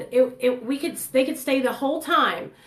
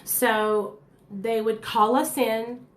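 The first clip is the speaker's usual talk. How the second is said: normal talking voice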